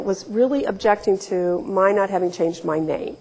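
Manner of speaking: usual speaking voice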